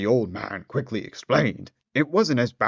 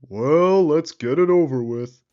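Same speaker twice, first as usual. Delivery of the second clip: resigned; 5 semitones higher, 4 dB louder than usual. Acted. Girl resigned.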